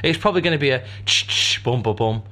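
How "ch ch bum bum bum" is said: sung jingle sting. Shown as a demonstration. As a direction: chant a short radio sting. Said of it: "put that soundbite in".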